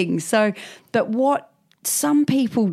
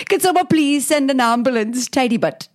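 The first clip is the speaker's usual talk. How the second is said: laughing mock Welsh plea, higher; 6 semitones higher than usual. Kebab eater begging for an ambulance, mock Welsh. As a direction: beg for help in a playful Welsh lilt.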